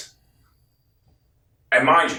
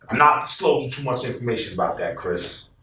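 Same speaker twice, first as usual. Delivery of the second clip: ordinary talk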